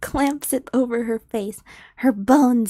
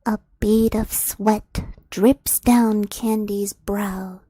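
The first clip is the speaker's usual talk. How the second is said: hushed, whispered scene setting; breathy storyteller hush; story narration; 3 semitones lower than usual. A narrator's reading. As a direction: draw them into the dark clearing, low and secret.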